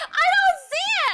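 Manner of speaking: high, loud laughing protest; shrieking disbelief, half laughing; laughing shout. Real, not acted. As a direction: yell it through laughter in disbelief. Laughing denial of hamster resemblance.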